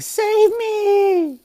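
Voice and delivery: high screeching cry for help; loud, drawn-out falsetto mock scream; Brulee. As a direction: imitate a helpless character yelling in panic. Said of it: "Brulee yelling".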